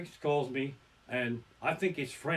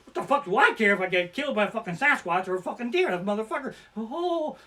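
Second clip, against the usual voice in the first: mock careless rant; 7 semitones higher, 5 dB louder than usual. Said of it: "'cause you're like".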